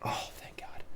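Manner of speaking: breathless whispered relief. Pretend. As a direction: exhale it as a quiet thank-you. Relief after feared play.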